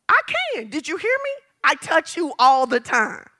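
high, heated comeback, re-enacted; indignant retort. Acted quarrel with husband.

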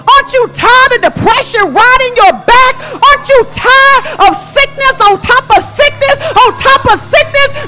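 high, ringing, shouted appeals; full-force preaching. Real, not acted. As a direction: cry out to the congregation with mounting fervour.

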